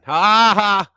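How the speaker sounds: a cheeky spelt-out ha ha; mock laugh. Real, not acted. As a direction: fake a gloating laugh at your co-host. Mocking his co-host.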